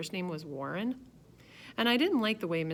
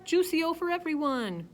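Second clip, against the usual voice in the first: announcing; 11 semitones higher, 5 dB louder than usual. A narrator's reading. Father announcing to guests.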